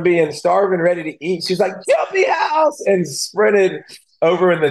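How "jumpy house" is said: put-on thrilled kid shout. Girl spots the jumpy house, shouting with glee. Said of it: "she was so excited".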